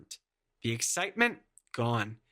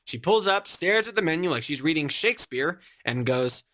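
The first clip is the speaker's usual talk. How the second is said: normal talking voice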